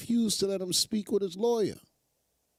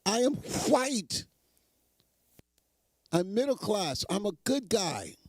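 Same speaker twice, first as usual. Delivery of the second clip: pitched-up mock imitation of the man; 4 semitones higher than usual. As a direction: parody him, a bit higher and whiny. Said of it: "He said".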